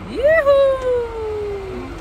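high, loud happy whoop; excited whoop. Real, not acted. Celebrating as the group reaches the place, loud whoop. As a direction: cheer as you arrive. Cheering on arrival.

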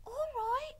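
high-pitched stage whisper. A narrator's reading. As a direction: agree in a high, hushed squeak. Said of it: "whispered Mig".

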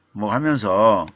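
normal talking voice

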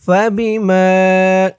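فبما with ما stretched for one alif; long ma. Shown as a demonstration. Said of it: "মা এক আলিফ টান". (فبما-তে মা এক আলিফ টেনে পড়া)

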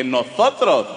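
loud mimicry of an accent from Spain; mock Spanish accent. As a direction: throw the Spaniard's accent back snootily. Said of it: "Yo también mamón le dije".

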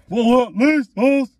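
high, loud double take; shocked disbelief. Real, not acted. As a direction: squeal in disbelief. Shocked at the watermelon candy.

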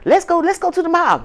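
high, eager coaxing; high urging friends. Retold or imitated. Friends begging her to come out, high and eager. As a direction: pitch it up like pushy friends.

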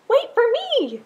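high, loud plea; pleading call. A narrator's reading. Little blue fish begging Rainbow Fish to wait, high loud call. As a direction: call out to be waited for.

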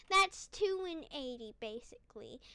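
normal talking voice

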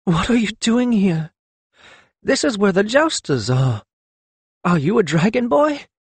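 high-pitched, questioning mother voice; astonished mother. A narrator's reading. Lettuce questioning her reunited son, astonished and high. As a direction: puzzle it out, question by question.

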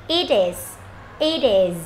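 "It is" run together, T sliding into "is"; linked sounds. Shown as a demonstration. Teacher demonstrating linking, "It is" joined up. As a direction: join "It is" as one word. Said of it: "chúng ta nối sang nào".

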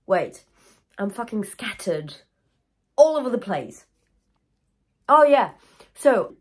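ordinary talk, nothing marked

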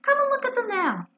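high-pitched, mildly surprised urging; pitched well up, faintly taken aback; a little surprised. Acted. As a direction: invite with a lifted, surprised voice. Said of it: "a little surprised".

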